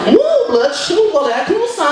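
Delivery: high squeaky mum impression; mother voiced. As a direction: play the flustered Portuguese mum.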